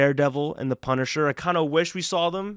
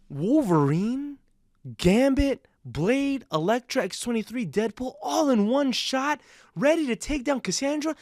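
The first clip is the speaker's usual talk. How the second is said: high-pitched, excited roll call, names held apart; building fan hype; 9 semitones higher than usual. Real, not acted. Reviewer hyping the heroes' team-up to viewers, excited. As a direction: list each hero with mounting excitement.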